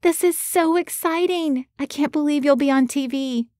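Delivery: high, bubbly assistant voice, thrilled; excited squeal. A narrator's reading. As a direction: squeal with delight about the TV spot. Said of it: "Tiffany jumped up and down".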